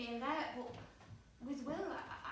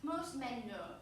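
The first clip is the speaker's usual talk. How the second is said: ordinary talk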